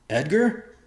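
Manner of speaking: high, loud, shocked question; startled. A narrator's reading. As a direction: caught off guard. Father startled by son.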